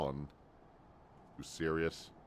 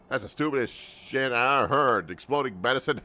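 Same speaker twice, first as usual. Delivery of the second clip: scornful shout; 7 semitones higher, 8 dB louder than usual. Acted. Mocking the medicine bomb claim.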